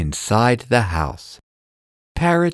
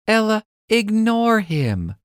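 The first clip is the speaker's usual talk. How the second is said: put-on high little-girl advice; calm girl's voice; 10 semitones higher than usual. Lizzie calming Ella, high girl voice. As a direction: gently tell your friend to ignore him. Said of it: "Lizzie says".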